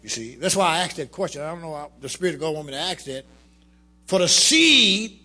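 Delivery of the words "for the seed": high, loud, slow preaching build; fervent preaching. Acted. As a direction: hammer it home from the pulpit. Preaching at full force.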